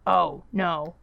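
lightly mimicked dismay; imitating husband. Wife mimicking her husband's reaction, lightly put-on. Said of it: "That's what he sounds like".

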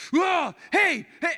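high, loud mock shout; imitated angry yelling. Angry man runs at the golfer, mock yelling. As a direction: yell like a man charging at someone.

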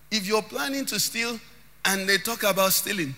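ordinary talk

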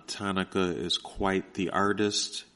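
ordinary talk, nothing marked

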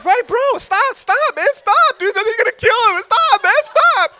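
put-on panicked boy shouting stop; frantic yelling. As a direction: yell at your brother to stop before he kills him. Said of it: "And I'm like".

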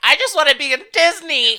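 high, loud eager plea; hamming up their Disney eagerness; mock eager whine. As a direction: gush it like a starstruck kid. Imitating their eager selves.

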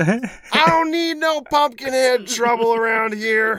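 put-on high, slow old coot grumbling; cranky old man. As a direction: grumble at the city folk. Grumpy old man voice continues.

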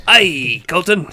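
loud, high mock pirate call; hammed-up pirate accent. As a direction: hail him like a pirate. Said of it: "I gave him a Pirate's accent".

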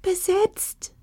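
high, desperate little squeaky voice; agitated little creature squeaking; excited squeak. A narrator's reading. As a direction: pipe up in a tiny, flustered voice. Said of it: "piepste eine verzweifelte Stimme aufgeregt".

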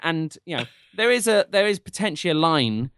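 ordinary delivery

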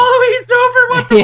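very high, loud squealing outrage; mock toddler outrage. Voicing the niece's outrage.

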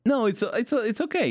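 defensive. Real, not acted. Brushing off teasing.

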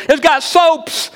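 put-on high, drawn-out toddler squeal of delight; excited little boy. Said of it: "He was so excited".

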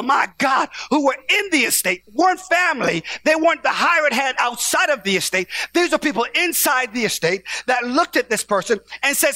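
high, loud, shouted preaching; full-force preaching. Real, not acted. Preacher shouting to the congregation that they are worthy.

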